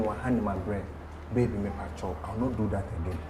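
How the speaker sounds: hushed, coaxing apology to her man; soft pleading. Retold or imitated. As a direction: plead softly like a sorry wife. Wife pleading sweetly with husband.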